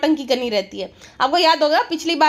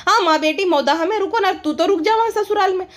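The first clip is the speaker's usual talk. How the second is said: ordinary talk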